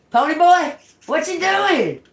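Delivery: high, loud sing-song call to the pet bird. Real, not acted. Owner calling her bird from across the room, high and loud.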